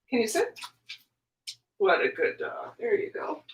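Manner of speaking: soft praise for a good dog; talking to a dog. Real, not acted. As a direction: coo gently at the dog.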